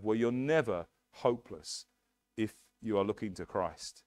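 normal talking voice

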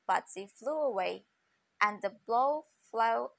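plain reading voice

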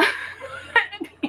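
soundless wheeze of laughter; silent wheezing laugh. Real, not acted. Cohost at the bail money joke, silent wheezing laugh. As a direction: laugh with almost no voice.

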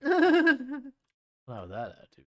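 high, slow teasing scold; mock scolding. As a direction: drag it out teasingly.

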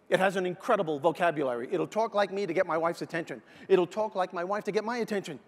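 normal talking voice

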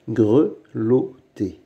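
"grelotter" slowly, in three separate beats; syllable by syllable. Shown as a demonstration. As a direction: sound out "grelotter" with pauses. Teacher splits word into syllables. (« grelotter » lentement, syllabe par syllabe)

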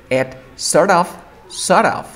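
sort of as "sor-of"; "sort of" run together; linked reduction. Shown as a demonstration. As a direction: read "sort of" with no T. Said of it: "voy a tratar de leer".

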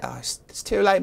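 ordinary talk

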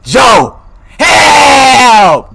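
loud holler stretched into a high wail; yelling for help. Acted. Trapped guest calling front desk man for rescue, yelling. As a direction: call out desperately, very loud.

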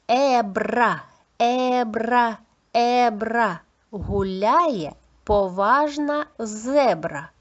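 rolled R in "Ебра" and "зебра", loud and crisp; clear articulation. Shown as a demonstration. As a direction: slowly drill "Ебра, ебра, ебра" with a long trill, then "зебра". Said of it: "Чітко вимовляйте, будь ласка, повторюйте".

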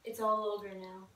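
low, soft, understated line; quiet delivery. Pretend. Player trying to make Jane laugh, quietly. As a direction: say it softly and low.

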